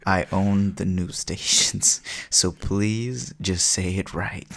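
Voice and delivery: slow, measured correction; insistent villain. Acted. Luthor demands his name said right, slow and insistent. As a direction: spell it out slowly, word by word. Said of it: "Lex Luthor's like".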